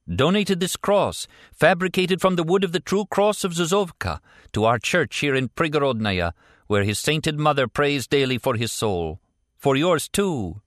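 fervent priest. Acted. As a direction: preach it with pious fervour. Priest speaks with fervour.

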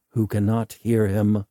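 ordinary delivery, nothing marked

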